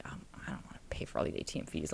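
hushed, fast worried whisper; breathless whispered aside about fees; retold whisper. Retelling her worried inner thought.

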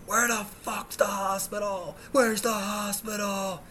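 high, slow drawn-out holler; screaming. Retold or imitated. Injured driver screaming at kids playing basketball, frantic holler. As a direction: scream it from a moving car, stretching the words. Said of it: "I'm screaming at them".

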